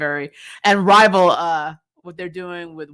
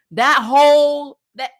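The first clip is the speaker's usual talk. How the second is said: slow, much louder and higher; emphatic outrage; 7 semitones higher, 9 dB louder, slower than usual. Real, not acted. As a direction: lean hard into the indignation. Ranting about bad writing.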